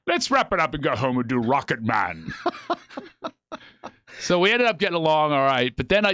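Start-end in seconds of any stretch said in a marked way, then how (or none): 0.1-2.3 imitated grand British thespian; Patrick Stewart. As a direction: announce it with mock stage grandeur. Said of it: "He goes".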